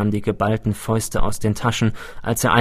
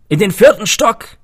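high, loud call from upstairs; 11 semitones higher, 8 dB louder than usual. A narrator's reading. Old man calling up to visitor below, loud and high. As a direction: call it down the stairwell. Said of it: "rief ein Mann von oben".

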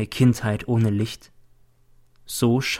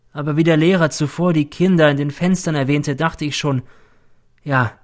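plain reading voice

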